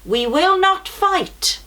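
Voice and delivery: usual speaking voice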